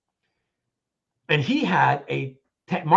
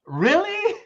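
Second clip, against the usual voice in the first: high, laughing disbelief; incredulous; 13 semitones higher than usual. Retold or imitated. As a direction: shoot the really up high through a laugh. Stunned at dealer's refusal.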